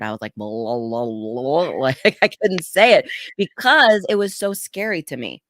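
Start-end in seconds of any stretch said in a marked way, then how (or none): 0.3-1.7 garbled blah blah blah in place of the word vulnerable; mumbling the word. Retold or imitated. Speaker can't say vulnerable, garbled mumbling. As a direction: mangle it into blah blah blah as if the word won't come out. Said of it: "I couldn't even pronounce the word".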